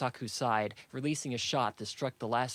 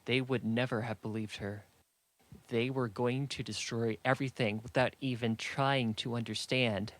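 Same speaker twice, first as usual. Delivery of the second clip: usual speaking voice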